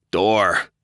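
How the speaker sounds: loud, sharp one-word bark; man barking an order at a door; barked command. Acted. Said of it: "he barked".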